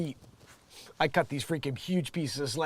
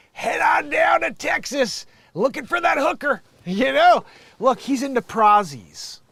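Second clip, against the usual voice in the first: loud, high Southern holler; mock a hammed-up Southern accent, shouting it; 10 semitones higher, 9 dB louder than usual. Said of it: "put on his best Southern accent".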